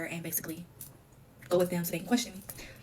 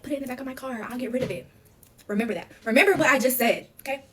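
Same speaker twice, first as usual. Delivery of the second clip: imitating a grandmother giving orders, pitched up; retold stern order, building louder towards the end; firm instructions; 6 semitones higher, 6 dB louder than usual. Nana to granddaughter about hiding evidence, imitated firm orders.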